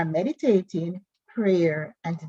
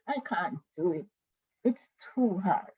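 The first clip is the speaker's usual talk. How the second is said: quiet, deflated give-up voice; defeated self-talk; 7 dB quieter than usual. Acted. Teacher voicing a student's negative self-talk, quietly defeated. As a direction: sigh out the words as if beaten.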